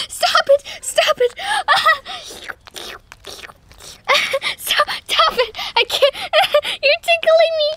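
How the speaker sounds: high, laughing squeals of stop it; giggling protest. Pretend. Toy girl laughing as the puppy tickles her. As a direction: beg through giggles while being tickled.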